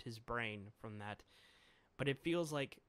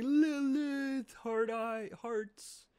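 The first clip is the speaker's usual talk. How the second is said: high, loud, swooning lovesick cry; hammed-up smitten squeal; lovestruck Sanji; 10 semitones higher, 8 dB louder, much slower than usual. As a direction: swoon over her, hearts in his eyes. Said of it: "Sanji's just like".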